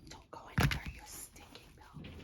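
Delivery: hissed whisper at the belt. Real, not acted. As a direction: whisper it like a scolding. Scolding the belt that slips away.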